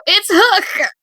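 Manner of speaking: high, delighted exclamation; happily surprised. Retold or imitated. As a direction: cry it out in happy surprise. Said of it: "my lovely surprise and happy when I go".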